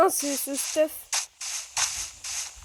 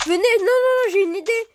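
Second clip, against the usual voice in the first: excited; 6 semitones higher, 5 dB louder than usual. Real, not acted. Kids playing Minecraft, sudden idea.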